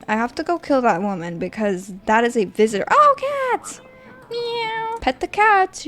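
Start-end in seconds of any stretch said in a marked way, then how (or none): 2.9-5.8 very high cooing meows; squeaky pet voice. Real, not acted. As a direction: coo at the kitty way up high. Baby talk to cat.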